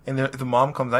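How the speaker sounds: ordinary delivery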